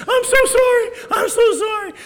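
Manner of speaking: high, loud tearful apology; in tears. Retold or imitated. Daughter crying sorry to mom, high tearful wail. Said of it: "burst into tears".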